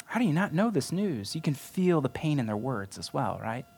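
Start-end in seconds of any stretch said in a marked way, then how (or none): 0.0-1.3 high, incredulous voice of disbelief; as if they can't believe he doesn't know; indignant disbelief. Retold or imitated. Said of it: "You almost feel their, their indignation".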